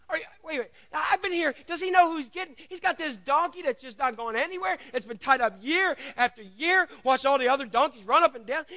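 high, loud, tumbling words; can't believe your luck; excited disbelief. Acted. Donkey marvelling to the men, high and excited.

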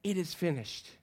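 soft, subdued last words; understated acting of a dying man; dying breath. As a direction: let the final words sink quietly. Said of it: "in his dying breath".

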